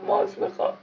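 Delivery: put-on high whiny kid voice; childish whine. Gamer whines in a high kid voice.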